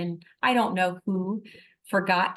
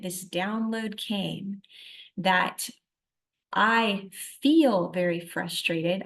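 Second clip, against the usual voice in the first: usual speaking voice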